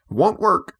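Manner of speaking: put-on a naughty boy talking back; naughty cry. A narrator's reading. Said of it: "Michael cried naughtily".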